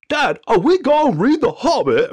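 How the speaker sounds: high, loud imitation of an eager son; kid's voice. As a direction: pipe up like an eager little boy. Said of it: "Evan's like".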